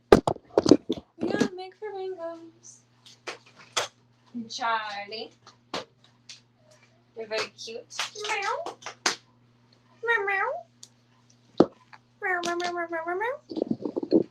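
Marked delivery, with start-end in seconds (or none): 0.1-2.8 high, slow and louder sing-song. Shown as a demonstration. Owner singing the plan to the pets, high slow sing-song. 8.3-14.2 high drawn meows; meowing. Pretend. Owner meowing at the cats, high and drawn. Imitating cat.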